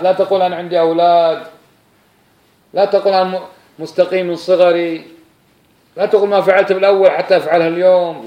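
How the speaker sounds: loud, high, rising warning refrain; preaching at full force. Acted. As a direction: hammer each "don't say" at the crowd.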